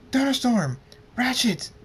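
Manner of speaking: high, loud cries for help; urgent scream. A narrator's reading. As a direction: scream each name in alarm. Calling out during the fight.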